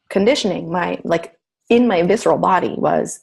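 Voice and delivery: ordinary delivery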